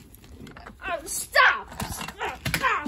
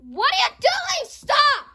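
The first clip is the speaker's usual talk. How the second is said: high, loud alarmed cry of stop; alarmed yell; 6 semitones higher, 4 dB louder than usual. Real, not acted. Yelling at the characters wrecking the house, high and loud. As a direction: yell it as things get wrecked.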